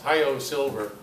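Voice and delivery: ordinary talk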